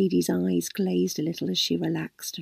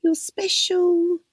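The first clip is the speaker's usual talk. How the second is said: slow, higher, unbelieving echo; startled echo; 11 semitones higher, much slower than usual. A narrator's reading. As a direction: repeat it as it sinks in. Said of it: "she started".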